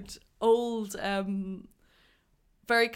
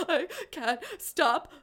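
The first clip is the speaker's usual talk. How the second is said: higher, slow, hammed-up crying voice; mock sobbing; 9 semitones higher, slower than usual. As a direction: wail it like a kid who can't stop sobbing. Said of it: "still sobbing through the".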